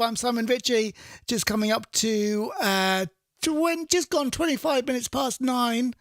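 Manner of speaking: chatty on-air DJ link with lifted, stretched key words; radio presenter. Acted. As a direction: present the show to listeners between songs.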